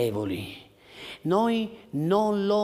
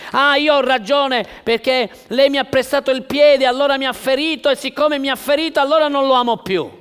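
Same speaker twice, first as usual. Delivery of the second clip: fast, petty self-justifying rant; mock aggrieved member; 9 semitones higher, 9 dB louder, faster than usual. Pastor mimicking a hurt church member, fast and petty. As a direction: rattle off the excuse like a sulking member.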